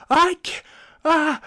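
high, loud strained yelp; overwhelmed strangled cry. Retold or imitated. Boy stunned by the roaring trucks: high, strained yelp. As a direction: cry out as the noise hits you.

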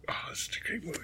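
soft whispered aside; whispering. Real, not acted. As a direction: breathe it out as a whisper. Fond aside about a movie.

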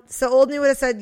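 ordinary talk, nothing marked

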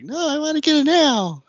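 put-on high, loud insistent kid; eager child voice. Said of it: "And I was like".

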